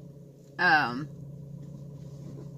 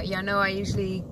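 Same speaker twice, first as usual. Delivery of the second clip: normal talking voice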